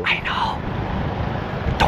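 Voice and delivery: soft conspiratorial whisper. Pretend. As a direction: breathe it as a secret. Keeping the mask secret.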